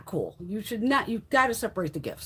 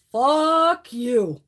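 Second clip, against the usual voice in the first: drawn-out, louder retold defiance; 3 semitones higher, 6 dB louder than usual. Employee proudly retells signing the write-up, loudly defiant. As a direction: stretch it out, loud and proud. Voicing how she signed write-up.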